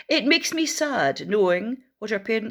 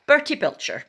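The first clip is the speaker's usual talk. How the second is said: ordinary delivery, nothing marked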